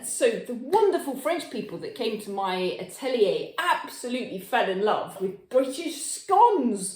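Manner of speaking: ordinary talk, nothing marked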